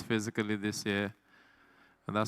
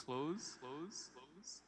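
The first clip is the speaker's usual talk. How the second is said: each "close" softer, fading to nothing; mock echo; 3 semitones higher, 18 dB quieter, much slower than usual. Host toys with the word as a fading echo.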